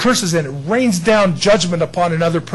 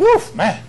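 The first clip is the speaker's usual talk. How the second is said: disgusted woof. Retold or imitated. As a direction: recoil from a roadside stench. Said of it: "And you go".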